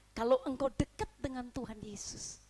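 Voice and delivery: usual speaking voice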